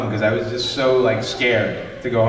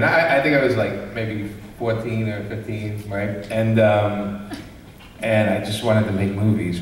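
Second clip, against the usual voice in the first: ordinary talk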